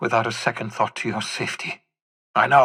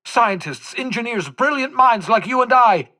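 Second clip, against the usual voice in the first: rising passion; 10 semitones higher, 3 dB louder than usual. Acted. Building passion about revived minds.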